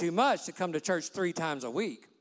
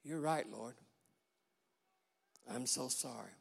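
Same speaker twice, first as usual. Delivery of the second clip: hushed, much softer, near tears; broken and tearful; 8 semitones lower, 12 dB quieter than usual. Retold or imitated. Peter weeps, softly telling the Lord he is sorry. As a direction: let it break into a soft apology. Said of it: "he began to weep".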